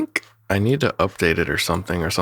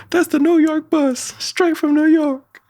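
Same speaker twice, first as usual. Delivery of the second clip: very high, loud pitched-up cartoon frog; Frog's cartoon voice; 5 dB louder than usual. Creator voicing Frog announcing the New York bus, squeaky and loud.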